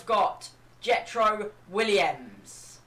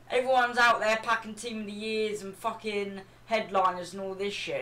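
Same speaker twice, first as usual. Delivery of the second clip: ordinary talk, nothing marked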